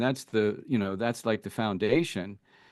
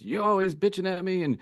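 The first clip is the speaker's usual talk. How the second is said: higher, sharper imitation of a partner griping; mock accusing whine, pitched up; mock nagging complaint; 7 semitones higher than usual. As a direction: act out the nagging spouse, a bit hammed-up.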